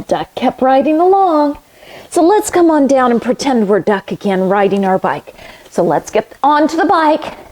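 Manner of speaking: usual speaking voice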